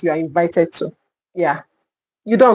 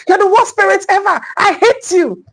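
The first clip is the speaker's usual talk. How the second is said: high, shrill-free mock teen outburst, much higher pitch; angry teenager; 12 semitones higher than usual. Parent imitating a teenager shouting at them, high mock voice. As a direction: play the sulky teenager yelling at the parent. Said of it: "If your teenager says".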